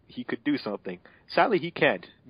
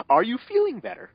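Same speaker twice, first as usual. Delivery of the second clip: much higher, put-on caring voice; concerned hero voice; 12 semitones higher, 3 dB louder than usual. Voicing Mega Man asking Roll, hammed-up concern. Reading fanfic line in role.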